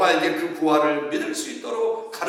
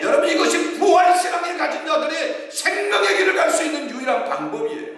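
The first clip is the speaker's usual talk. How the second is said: shouted preaching, much higher and louder; preaching at full force; 12 semitones higher, 3 dB louder than usual. Acted. Pastor climaxing the sermon to the congregation, shouting. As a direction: proclaim it with everything you have.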